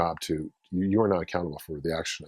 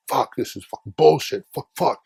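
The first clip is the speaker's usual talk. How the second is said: loud, high, imitated angry cursing; worked-up rant; 7 semitones higher, 5 dB louder than usual. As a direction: mimic him cursing, all worked up. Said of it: "he's all getting worked up".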